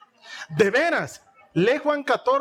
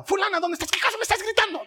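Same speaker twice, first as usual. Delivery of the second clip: much higher, indignant mother voice; shrill mother; 10 semitones higher, much faster than usual. Retold or imitated. Mother scolding her mouthy daughter, high indignant. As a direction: shriek it like an exasperated mom.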